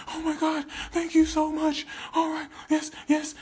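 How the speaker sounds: very high, loud squealing gratitude; overjoyed healed man; gushing thanks. Retold or imitated. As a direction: squeal your thanks like a giddy fan. Healed people thanking Jesus.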